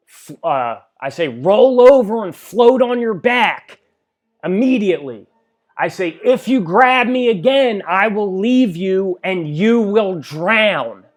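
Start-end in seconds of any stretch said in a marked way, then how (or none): 1.3-5.3 high, loud order, no nonsense; stern command. Retold or imitated. Lifeguard orders the panicking swimmer, loud stern shout. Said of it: "I scream at the guy". 6.2-11.0 loud, high warning, slowing on the threat; stern warning. Retold or imitated. Warning the drowning man not to grab him, loud and slow. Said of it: "I scream at the guy".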